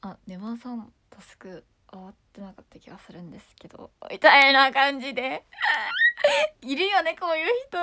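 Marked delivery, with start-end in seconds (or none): 0.0-3.9 whispered low and much softer; low whispered fake-shy voice; timid whisper. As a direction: breathe it out like a shy player. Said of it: "こういう声作ってる人いる".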